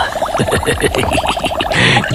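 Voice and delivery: unvoiced gloating laugh; breathy evil snicker. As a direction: snicker like a mad scientist. Mad scientist gloats.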